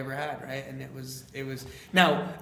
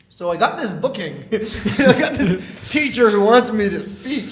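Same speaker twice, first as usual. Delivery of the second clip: loud, high, laughing through the words; giddy laughing; 7 semitones higher, 13 dB louder, slower than usual. Retold or imitated. Telling Shane about the teacher's booking, giddy laughing. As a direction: share the news as if barely believing it.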